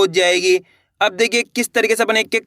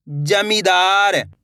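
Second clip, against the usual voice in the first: जमींदार stretched out very slowly, each syllable held; much slower than usual. Shown as a demonstration. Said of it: "एक अक्षर का उच्चारण". (जमींदार, एक-एक अक्षर खींचकर)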